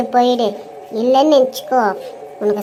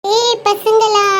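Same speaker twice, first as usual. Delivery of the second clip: put-on calling the kids; 8 semitones higher, much slower than usual. Mother calls to her kids.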